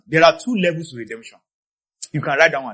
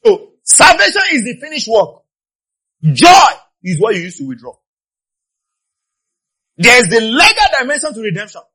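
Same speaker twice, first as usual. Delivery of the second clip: high, loud sermon cry; raised pulpit shout; impassioned preaching; 6 semitones higher, 9 dB louder than usual. Real, not acted. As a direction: proclaim it to the whole congregation. Preaches at full force.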